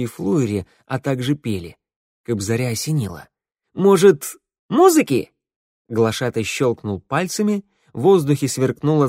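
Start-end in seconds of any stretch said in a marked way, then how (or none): 3.7-5.3 bright idea. Acted.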